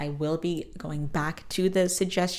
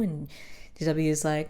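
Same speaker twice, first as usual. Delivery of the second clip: ordinary talk, nothing marked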